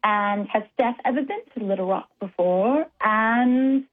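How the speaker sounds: halting, put-on British accent. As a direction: attempt a British accent while searching for the question. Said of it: "quite hard in my British accent".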